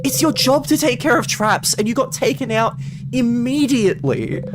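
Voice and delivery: raging at your own mistake; furious self-berating. Acted. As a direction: tear into yourself for failing. Furious self-scolding over trap.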